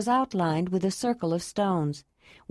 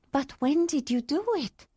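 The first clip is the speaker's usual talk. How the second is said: higher, surprised woman's voice; 7 semitones higher than usual. A narrator's reading. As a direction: ask in happy surprise. Ayla surprised.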